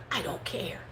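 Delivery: whispered, breathless shrug; soft whispered I don't care; whispered aside. Real, not acted. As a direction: whisper it off as nothing. Dismissing insult.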